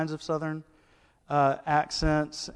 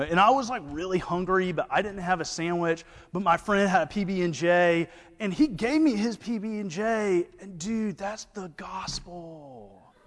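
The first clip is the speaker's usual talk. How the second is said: higher surfer dude twang; Brewton surfer-Southern accent; 6 semitones higher than usual. Retold or imitated. Connor at Bible study, a surfer-dude Southern accent. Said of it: "he goes".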